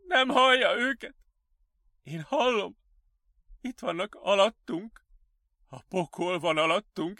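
high, anguished pleading building to shouts; desperate patient. Acted. Patient begging staff to let him see, desperate. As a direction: plead frantically, close to tears.

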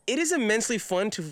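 normal talking voice

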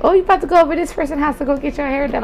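lightly mimicked parent, rushed and high; imitated mom. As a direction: rattle off mom's question high and light. Voices her mom's question.

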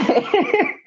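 very high amused giggle; a squeaky disbelieving laugh. Real, not acted. Laughs at friend's sudden sulk.

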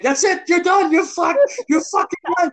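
high, loud mock scammer shout; imitating scammers yelling. Scammers yelling back on the phone, hammed-up high shout. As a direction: yell it back furiously, hammed-up. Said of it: "I can hear them on the other end".

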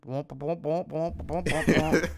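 imitated muffled cartoon grown-up babble; wordless womping in place of speech; womp-womp talking noise. Imitating her yelling over the dubstep, womping noises. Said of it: "to be her talking".

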